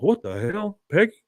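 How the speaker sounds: a touch of alarm; mock bewildered Hank; confused outburst. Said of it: "looks around and goes".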